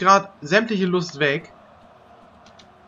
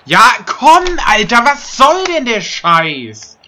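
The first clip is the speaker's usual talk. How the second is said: berserk rage; 3 semitones higher, 6 dB louder than usual. Real, not acted. Raging at football game.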